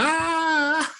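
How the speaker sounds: very high, long shriek, then laugh; mock bar-regular squeal, way up high; imitated drunken squeal. Imitating her carrying on at Applebee's.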